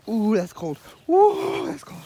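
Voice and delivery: squealing high ooh at cold water; cold shock. Real, not acted. Hiker feeling cold water in his shoes, high yelp. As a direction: yelp at the icy wet.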